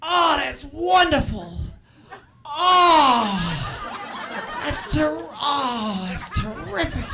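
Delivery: happy for you but in pain; high, drawn-out, tearful congratulations, hammed up; [name]. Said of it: "they sound like they're in pain also".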